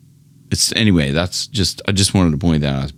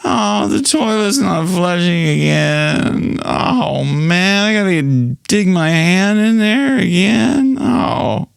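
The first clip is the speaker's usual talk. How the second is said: high whiny moan with a sob; hammed-up whining about the toilet; whining cry; 8 semitones higher, slower than usual. Shown as a demonstration. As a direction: moan like the chore is torture. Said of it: "just to go".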